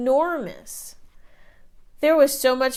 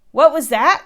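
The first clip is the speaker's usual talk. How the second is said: louder, higher startled demand; startled shout; 5 semitones higher, 9 dB louder than usual. A narrator's reading. Giant hears a voice from the barrel. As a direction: bark it at the room. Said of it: "cried the giant".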